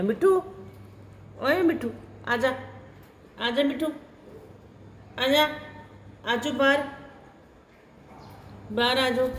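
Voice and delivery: high, sweet calling to a pet bird; coaxing pet voice. Real, not acted. Calling parrot out again. (ਤੋਤੇ ਨੂੰ ਪਿੰਜਰੇ 'ਚੋਂ ਬੁਲਾਉਂਦੀ, ਉੱਚੀ ਮਿੱਠੀ ਆਵਾਜ਼)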